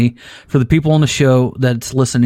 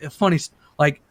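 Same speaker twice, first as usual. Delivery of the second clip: ordinary delivery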